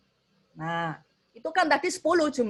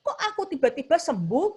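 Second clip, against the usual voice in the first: amazed; 7 semitones higher, 3 dB louder than usual. Acted. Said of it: "mereka takjub luar biasa".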